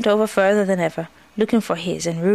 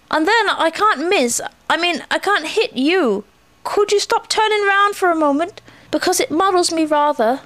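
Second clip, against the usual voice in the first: high, slightly flustered character voice; muddled Pooh; 9 semitones higher than usual. A narrator's reading. Pooh asks Eeyore to stop turning, muddled. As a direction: explain haltingly, pitched up.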